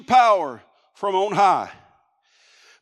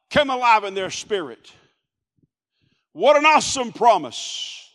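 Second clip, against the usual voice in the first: ordinary delivery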